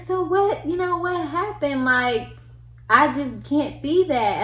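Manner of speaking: high, gently puzzled officer; concerned officer. Retold or imitated. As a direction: ask kindly, unconvinced she's guilty. Sympathetic officer quoted.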